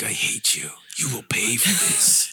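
low, slow menacing whisper; seething shrieking spirit. Retold or imitated. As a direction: hiss it like a vengeful ghost.

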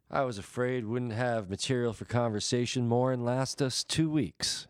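stagey country drawl. Acted. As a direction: recite the line with a folksy drawl.

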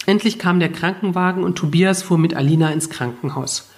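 usual speaking voice

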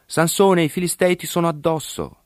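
loud, high-pitched warning cry; grido d'allarme. A narrator's reading. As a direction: cry it out high, as an urgent alarm. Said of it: "Essa gli gridò". (grido acuto d'allarme)

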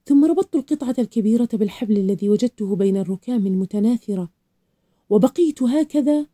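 usual speaking voice

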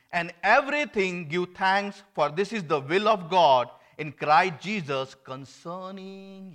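loud, high preaching of the verse; fervent pulpit proclamation; preaching scripture. Acted. Loud scripture reading in sermon.

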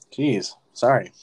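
normal talking voice

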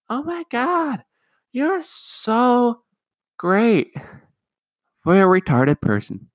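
fake-gush then twist the knife; backhanded compliment. Mocking family's backhanded praise of son, sarcastic gushing. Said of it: "it's a backhanded compliment".

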